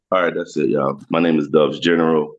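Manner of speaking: normal talking voice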